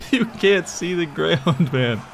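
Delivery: can barely speak for laughing. Real, not acted. Laughing at the huge army.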